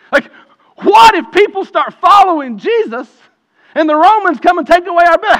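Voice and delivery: high, loud, impassioned sermon climax; fiery preaching. Acted. Pastor railing against religious leaders, loud and high. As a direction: preach it full force, building outrage.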